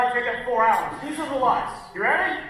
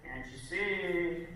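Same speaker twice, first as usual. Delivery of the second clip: low, soft, drawn-out inner nag; bitchy little voice; 5 semitones lower, 9 dB quieter, slower than usual. Acted. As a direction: play the nagging doubt in your head, low and quiet. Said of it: "That's what my bitchy little voice sounds like".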